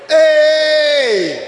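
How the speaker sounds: long, loud, high "hey"; shouted exclamation. Real, not acted. Preacher rousing the church over marrying early, long shout. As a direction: holler it out and hold the note. Preacher cries out after marriage line.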